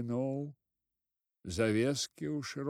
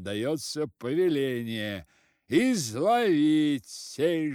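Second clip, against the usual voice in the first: pompous decree; 3 semitones higher, 8 dB louder than usual. A narrator's reading. Village head decreeing to the constables, pompous loud order. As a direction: proclaim it with authority.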